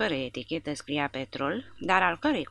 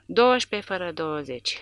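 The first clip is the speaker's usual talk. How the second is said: usual speaking voice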